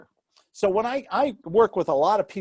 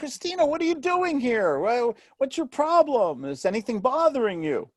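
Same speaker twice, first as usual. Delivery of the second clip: higher, lightly voiced concerned doctor; a doctor greeting a patient, gently; mock doctor asking a patient what is wrong; caring doctor; 8 semitones higher than usual. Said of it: "what does she say to you".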